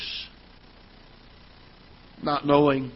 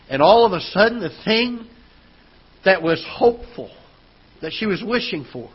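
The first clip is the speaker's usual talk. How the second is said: raised, louder preaching voice; 8 semitones higher, 6 dB louder than usual. Acted. Pastor preaching to his church, raised and forceful. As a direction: preach it out to the congregation, building.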